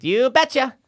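put-on high, chirpy Wisconsin old lady; hammed-up high Wisconsin catchphrase; elderly Wisconsin woman. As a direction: squeak it out like a Midwestern granny. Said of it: "elderly woman from Wisconsin that answers".

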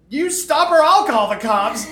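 shouted retort, slowed at the end; retold shout. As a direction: throw the threat right back. Shouting back at woman.

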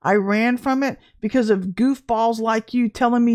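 usual speaking voice

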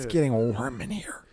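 hushed whispered aside; whispering. Real, not acted. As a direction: breathe it out as a whisper.

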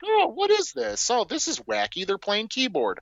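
high-pitched mock child wonder; imitated wide-eyed child. Dad mimicking what his daughter might have said, high mock wonder. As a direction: put on a small, high kid voice. Voices a child's hoped-for reaction to film.

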